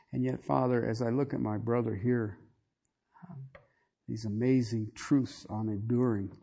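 soft, low, reverent pastoral prayer; closing prayer. Acted. Pastor praying over his brothers and sisters, hushed and reverent. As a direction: pray quietly over the people.

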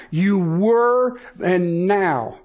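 very slow, "were" held before "now"; drawn-out contrast of "were" and "now"; emphasis on were. Shown as a demonstration. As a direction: stretch "were", then set "now" against it. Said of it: "the emphasis is on the verb".

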